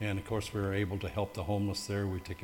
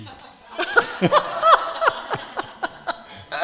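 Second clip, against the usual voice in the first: loud, high peal of laughter; big burst of laughter; 13 dB louder than usual. Real, not acted. Teased by his colleague, laughing out loud. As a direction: really crack up laughing.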